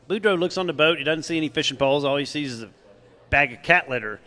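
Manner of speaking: ordinary delivery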